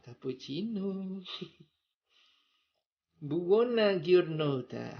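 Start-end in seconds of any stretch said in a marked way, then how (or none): 3.2-5.0 slow, laughing Italian greeting; Italian accent. Shown as a demonstration. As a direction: warm Italian wish, drawn out. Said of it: "sudah bisa logat Italia saya".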